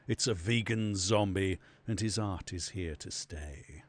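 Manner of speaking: measured, solemn verse reading; mock-epic narration, dropping low; poetry recital. Reads out a verse.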